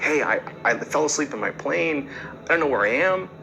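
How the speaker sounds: a lost pilot's rushed, worried plea; frantic. Retold or imitated. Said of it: "he's totally frantic".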